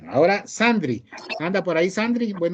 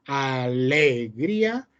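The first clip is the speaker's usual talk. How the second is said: "alegría" stretched slowly, stress moved onto LE; stress on wrong syllable; much slower than usual. Shown as a demonstration. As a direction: lean long and hard on the LE in "alegría". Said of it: "tampoco es". ("alegría" alargada, con la fuerza en LE)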